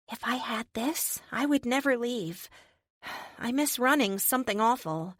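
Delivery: a hint of a shy girl turning giddy; little girl. Wheelchair girl meeting her armored hero, lightly voiced high girl.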